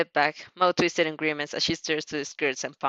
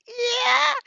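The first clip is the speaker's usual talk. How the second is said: high cheering yell; excited yell. Acted. You shouting back to President Mike, high and eager. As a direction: yell yeah to the crowd.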